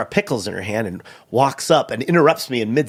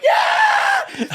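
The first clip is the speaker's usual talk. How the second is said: cheering shout. Retold or imitated. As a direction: shout it to the whole room. Turns around and is like.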